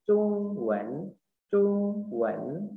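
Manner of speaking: CHUNG held long, high and level, WEN rising; tone drill. Shown as a demonstration. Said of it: "Chung nada satu". (latihan "Chung" tinggi panjang, "Wen" naik)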